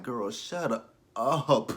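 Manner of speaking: put-on drawn out, very slow "girl, shut up"; dismissive sass. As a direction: drag it out, dripping with attitude. Dismissing a TV character.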